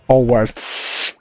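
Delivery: "Hogwarts" as a low "Owarts", no H; dubber's mispronunciation. Retold or imitated. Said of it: "he says Hogwarts".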